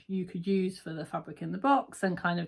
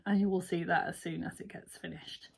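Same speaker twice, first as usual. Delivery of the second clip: whisper; 7 dB quieter than usual. Real, not acted. Teasing a future video.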